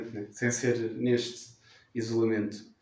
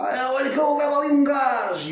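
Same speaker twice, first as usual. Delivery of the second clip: higher mocking thug; 12 semitones higher, 4 dB louder than usual. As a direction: sneer like a street tough. Imita agressor. (imitação de rufia, mais aguda)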